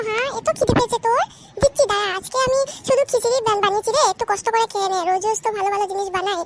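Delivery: ordinary delivery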